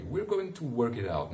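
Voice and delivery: ordinary delivery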